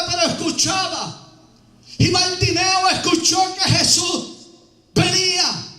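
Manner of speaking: full-voiced preacher's storytelling; preaching. Preacher tells the congregation about Bartimeo, preaching.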